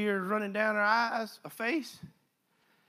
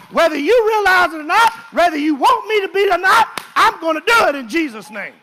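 loud, high preaching shout; full-force preaching; 7 semitones higher, 13 dB louder than usual. Acted. Preacher rallying the church, shouting high and loud.

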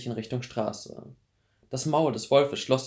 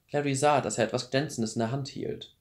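plain reading voice

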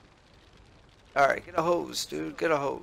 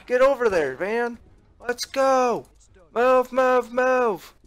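shouting orders over battle; 10 semitones higher, 8 dB louder, much slower than usual. Real, not acted. Urging units in hectic game.

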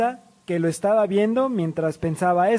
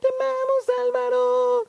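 very high squealing fangirls; sky-high mock scream; imitated fan screaming. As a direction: squeal like adoring fans, without much volume. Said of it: "En eso gritan".

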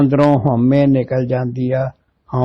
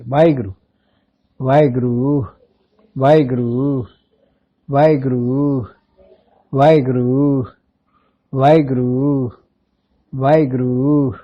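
quiet simran; 2 semitones lower than usual. Shown as a demonstration. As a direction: repeat it inwardly, steady and calm. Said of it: "ਚਾਰ ਘੰਟੇ ਸਿਮਰਨ ਕਰ". (ਧੀਮੀ ਅਵਾਜ਼ ਵਿੱਚ ਸਿਮਰਨ)

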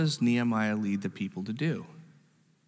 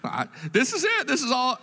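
high-pitched mock plea to God; hammed-up wail of someone with nothing else; mock helpless prayer; 11 semitones higher than usual. Preacher imitating someone who only prays to God, mock helplessness. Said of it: "it's not like we just go".

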